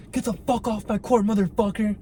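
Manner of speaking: high-pitched mock yell at the player; mock angry yell. Ben Shapiro yelling at the player, mock high angry shout. Retelling Shapiro's cameo outburst.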